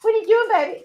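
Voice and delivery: high, cooing pet voice; high sing-song voice for her little dog. Real, not acted.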